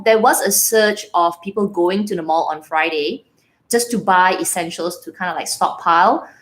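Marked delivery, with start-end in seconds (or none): none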